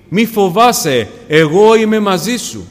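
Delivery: loud, high, slow proclamation; ringing preacher's encouragement; emphatic reassurance. Acted. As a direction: proclaim it to the congregation, stretching the words. Voicing the Lord's encouragement, preached loudly.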